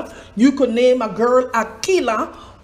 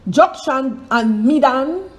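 usual speaking voice

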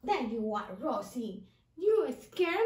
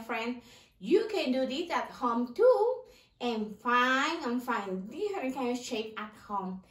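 normal talking voice

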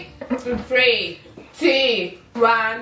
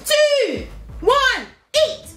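shouted start countdown; 9 semitones higher, much slower than usual. Real, not acted. Starts the eating contest.

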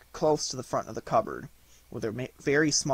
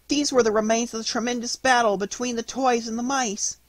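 put-on high-pitched, earnest child voice; excited little girl; 10 semitones higher, 5 dB louder than usual. A narrator's reading. Marie explaining the battle to mother, high agitated voice.

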